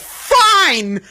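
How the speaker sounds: a loud, high huffy "Fine!"; indignant snap. Retold or imitated. Mother to her son after being banned from the store, loud huffy snap. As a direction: toss the word off in a huff.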